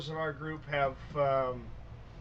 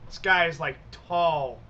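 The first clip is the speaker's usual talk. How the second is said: normal talking voice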